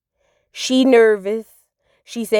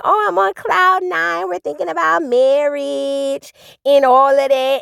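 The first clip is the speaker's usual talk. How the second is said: voicing a smitten friend; 5 semitones higher, slower than usual. Blissful friend quoted.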